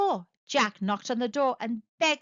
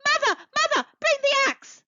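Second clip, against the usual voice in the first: very high, loud urgent yell; Jack yelling; 6 dB louder than usual. A narrator's reading. Jack yells to his mother from the beanstalk, high and loud. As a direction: shout down for help in a panic.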